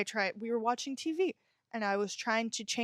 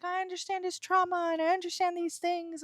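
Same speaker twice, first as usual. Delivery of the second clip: much higher, sing-song naive excuse; mock understanding victim; 9 semitones higher than usual. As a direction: parody someone excusing an abuser's trauma. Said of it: "you're like".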